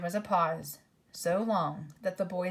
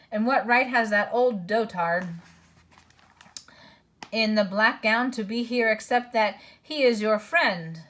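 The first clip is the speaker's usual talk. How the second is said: angry dwarf voice; 3 semitones higher, 7 dB louder than usual. A narrator's reading. Dwarf arguing.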